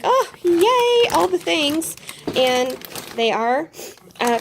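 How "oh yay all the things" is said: higher and louder, a delighted little cheer; retold excitement at a great deal; excited yay. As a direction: squeal over the find like a bargain win. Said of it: "So I was like".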